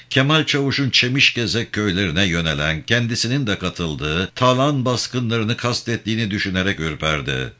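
ordinary delivery

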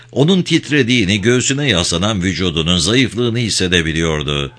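plain reading voice

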